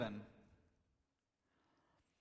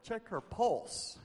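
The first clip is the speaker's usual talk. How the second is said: higher, slow mock retort; incredulous mourners; 7 semitones higher, slower than usual. Mourners told she is asleep, incredulous. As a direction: imitate a disbelieving mourner, stretched out. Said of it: "They're like".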